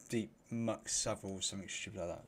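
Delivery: ordinary delivery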